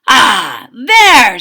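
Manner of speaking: loud pleased sigh of a word; satisfied. A narrator's reading. Cook admiring his new sign, satisfied. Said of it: "said Mister Angelo to himself".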